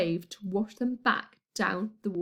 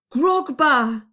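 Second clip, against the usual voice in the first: loud yell across the garden; 4 semitones higher, 8 dB louder than usual. A narrator's reading. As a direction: call out his name loudly. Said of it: "I yelled".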